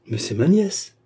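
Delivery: high, rising exclamation of surprise; astonished cry. A narrator's reading. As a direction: cry out in astonishment, pitch climbing. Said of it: "s'écria la baronne".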